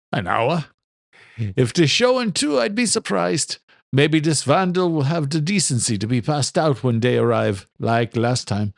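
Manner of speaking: lightly voiced Irish father, dry; Irish brogue, amused correction; a hint of an Irish father. Father's voice in story.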